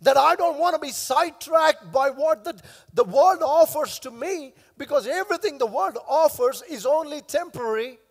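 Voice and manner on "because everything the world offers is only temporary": normal talking voice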